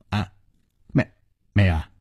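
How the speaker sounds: halting, stammered small reply; flustered denial. Acted. Bai Yumo admits he never asked, flustered and halting. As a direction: falter and break up the denial. Villain cornered by chief's question.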